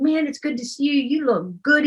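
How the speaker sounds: glad greeting. Retold or imitated. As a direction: imitate him eagerly welcoming her. Retold glad greeting from boyfriend.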